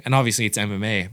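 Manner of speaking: usual speaking voice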